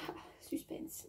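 hushed mock-dramatic whisper; whispered tease. Vlogger teasing viewers about a canary rivalry, playful whisper.

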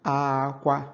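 teacher lands "Akwa" high and clear. Shown as a demonstration. Igbo teacher demonstrating high-tone "Akwa". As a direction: say "Akwa" with both syllables on a high tone. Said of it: "which is high, high".

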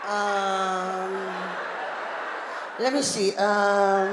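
long drawn-out thinking ums; stalling. Retold or imitated. Mother making up things for her carer, stalling. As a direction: stretch out each um while inventing a task.

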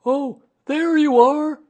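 high, loud, drawn-out call; Pooh, calling down. A narrator's reading. Pooh calling down to the returning boy, loud hail. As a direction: hail a returning friend from above. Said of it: "called down Winnie the Pooh".

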